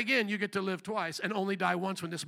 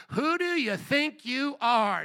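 drawn-out, higher-pitched challenge; indignant Karen; 4 semitones higher, slower than usual. Acted. Crowd challenging Jesus, indignant Karen. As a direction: demand it slowly, like an affronted Karen. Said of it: "They had Karens back in the Bible".

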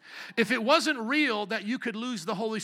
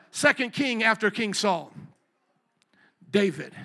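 ordinary delivery

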